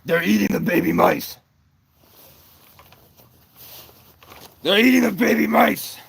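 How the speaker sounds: high, loud mock-outraged yell; shouted accusation.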